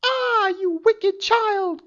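high furious crone cry; angry witch cry. Acted. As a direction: scold the girl like a furious witch. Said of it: "cried the enchantress".

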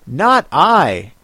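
usual speaking voice

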